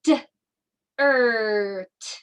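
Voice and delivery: "D, er, t" as three separate sounds; sounded out. Shown as a demonstration. Teacher sounding out a word for children, segmented phonics. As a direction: pause between each sound of "D, er, t". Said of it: "let's put it all together".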